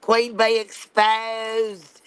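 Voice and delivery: Queen Bee exposed, drawn out very slowly; a slow, theatrical reveal; dramatic mock announcement. Friend drags out the reveal to tease the streamer, very slow.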